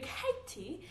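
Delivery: playful mock British accent, drawn out; joking British accent. Vlogger teasing a British friend, mock British accent. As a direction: put on a jokey British accent. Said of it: "con ella siempre hablo así como que con acento británico, así como que de broma".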